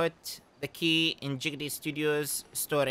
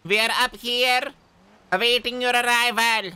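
loud high call from above; 9 semitones higher, 7 dB louder than usual. Acted.

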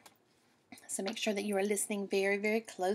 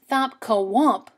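louder, slow sound words; loud thump; 8 dB louder, slower than usual. Acted. Reading the bear's landing, loud and slow. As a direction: boom out the thump.

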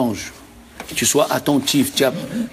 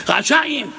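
shouting; 12 semitones higher than usual. Retold or imitated. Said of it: "Qu'est-ce qu'il a dit en criant l'autre?".

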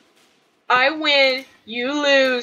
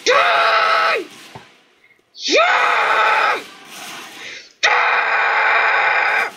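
drawn-out screamed battle cries; raging gamer shouts; pure rage; 6 semitones higher, much slower than usual. Real, not acted. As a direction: scream each one higher than speech, stretched long. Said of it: "out of pure rage".

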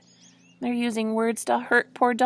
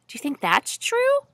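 usual speaking voice

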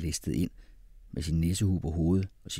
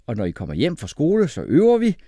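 high, loud, upbeat instructions; rally the children gladly; bouncy, happy father giving orders; cheerful; 9 semitones higher, 8 dB louder than usual. A narrator's reading. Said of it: "sagde han glad".

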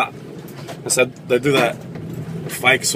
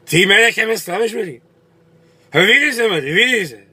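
acted angry husband; 8 semitones higher than usual. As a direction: berate the wife for shaming you. Drunk husband berating his wife at home.

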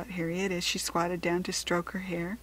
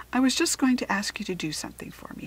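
quick, slightly raised request; said quickly; 6 semitones higher, 4 dB louder, faster than usual. A narrator's reading. Father cuts in on daughter's offer, said quickly. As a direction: hurry in with a favour to ask. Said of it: "said her father quickly".

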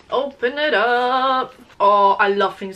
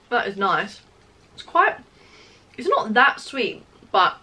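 chewing while talking; muffled by food; mouth full; 3 semitones higher than usual. Real, not acted. Seen 0: bites cake, chews and talks with full mouth throughout.

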